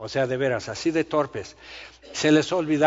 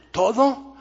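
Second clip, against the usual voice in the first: high, louder disbelieving "all of it?"; astonished question; 9 semitones higher, 5 dB louder than usual. Pretend. New believer asking the preacher, amazed and disbelieving. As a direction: ask it like you can't believe you're forgiven.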